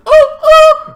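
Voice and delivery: two high, loud oohs; high hammy ghostly ooh-ooh; mock spooky hoot. Vlogger hamming up the pain house, high hooting.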